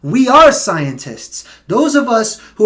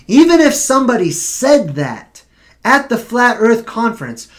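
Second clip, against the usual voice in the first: normal talking voice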